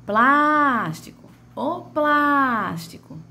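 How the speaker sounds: high, slow "plástico" with "plás" dragged long; "plástico" sung out on a long first syllable; stretched first syllable. Shown as a demonstration. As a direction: call out "plástico", holding "plás". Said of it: "que foi mais arrastada".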